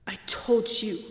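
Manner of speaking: hissed under the breath; a cold menacing whisper to the hunter. Acted. Countess angrily whispers.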